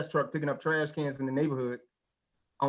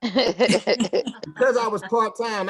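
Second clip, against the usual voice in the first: laughing through words; 3 semitones higher, 6 dB louder, much slower than usual. Real, not acted.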